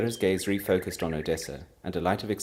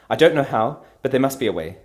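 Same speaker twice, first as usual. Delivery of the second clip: fierce vow; 6 semitones higher, 12 dB louder than usual. Acted. Vows to defeat the witch.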